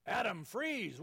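God imagined as an arresting officer; police officer. Retold or imitated. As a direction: bark it like a cop at an arrest. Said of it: "as an arresting police officer".

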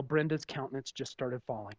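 normal talking voice

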